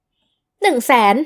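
mother names her price, loud and deliberate; loud and clear. Acted. Spaced-out loud demand. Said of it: "พูดเสียงดังชัดถ้อยชัดคำ".

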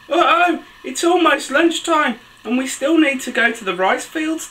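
high-pitched picture-book uh-oh; mock alarm. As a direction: sound the alarm for little listeners, pitched high. Story heroine alarmed about time.

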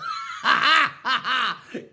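loud belly laugh at own joke. Real, not acted. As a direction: roar at your own punchline.

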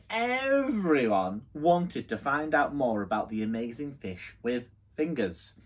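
lively, sing-song storybook voice; reading to children. Acted. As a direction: read it like bedtime story time for kids.

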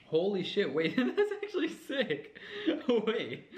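high, laughing amazement; surprised laughing. Real, not acted. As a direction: burst out in delighted disbelief. Surprised candy tastes sweet.

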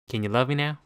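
normal talking voice

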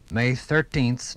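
normal talking voice

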